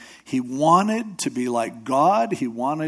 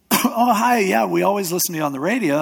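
gushing fan; 6 semitones higher, 5 dB louder, faster than usual. Retold or imitated. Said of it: "They go".

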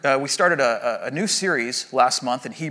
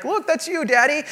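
put-on high, sweet little-kid voice; imitating small child; 11 semitones higher than usual. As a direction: pipe up like a kid showing Daddy a drawing. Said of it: "and you're like".

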